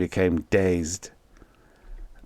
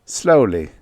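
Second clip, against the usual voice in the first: usual speaking voice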